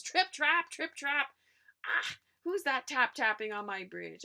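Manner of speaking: loud, high troll challenge; troll challenging the biggest goat; troll calling. Acted. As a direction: bark the trip trap up at the bridge. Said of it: "asks Troll".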